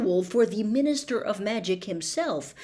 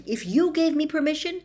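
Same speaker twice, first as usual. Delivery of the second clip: eager; 8 semitones higher, 4 dB louder than usual. Acted.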